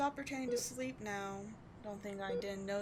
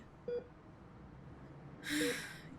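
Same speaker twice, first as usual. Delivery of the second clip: theatrical sigh; 6 semitones higher, 4 dB quieter than usual. Acted.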